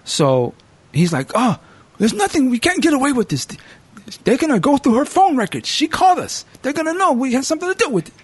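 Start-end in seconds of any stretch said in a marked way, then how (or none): 1.3-8.1 high put-on Bulgarian-Italian accent; mock Bulgarian-Italian accent, pitched high and fast at the end; mixed Bulgarian-Italian accent. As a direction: play the panicking brother in a Bulgarian-Italian accent. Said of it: "giving it that Bulgarian, Italian accent".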